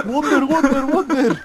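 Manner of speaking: high laughing excited chant; excited cheer. Real, not acted. Excited cheer mid game.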